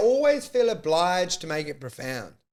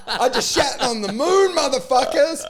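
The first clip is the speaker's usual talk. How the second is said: crowing it out louder and higher; mock triumphant shout; 6 semitones higher, 4 dB louder than usual. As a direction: boast like you just conquered the moon. Astronaut boasting on the moon.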